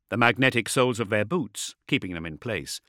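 plain reading voice

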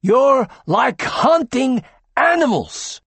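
doctor scorning the mob; scathing rebuke. Acted. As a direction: spit it at the crowd. Tachyon rebukes crowd.

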